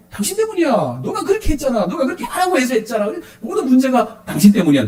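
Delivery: a playful quarrel voice pointing the finger; mock accusing spouse. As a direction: throw the blame at your spouse, hammed-up. Acted couple quarrel, blaming spouse.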